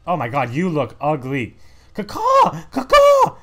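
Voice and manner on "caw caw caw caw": very high, loud cawing; crow caws. Pretend. Crow fighter cawing at the player, loud high caws. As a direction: squawk like a crow. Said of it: "I am a crow who eats".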